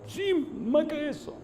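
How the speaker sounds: slow, very high strained voice; imitating a distraught man insisting; insistent agreement. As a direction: draw it out high. Retold sinner insists further.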